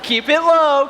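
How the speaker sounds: high, loud re-enacted coaching; hammed-up panicky encouragement; frantic coaching. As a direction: call it out high and urgent, half laughing. Coaching wife through her screaming.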